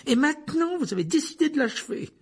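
high, loud bitter charge; angry accusation. A narrator's reading. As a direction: throw the accusation at him.

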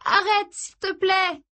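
high, drawn-out plea; supplication. A narrator's reading. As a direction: beg him to stop, voice climbing way up. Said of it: "le supplia-t-elle". (voix suppliante, très aiguë)

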